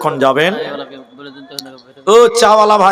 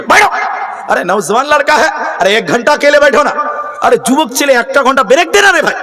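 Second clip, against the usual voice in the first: imitated angry scolding; 7 semitones higher than usual. Speaker scolding a young man for leaving, hammed-up anger. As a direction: act out an exasperated preacher telling someone off. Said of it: "বক্তা তখন রেগে গিয়ে বলছে".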